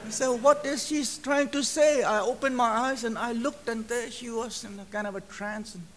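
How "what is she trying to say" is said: high, louder puzzled voice; bewildered question. Retold or imitated. Preacher wondering at the woman's message, bewildered. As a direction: ask it as if stunned by what you heard. Retells his stunned reaction.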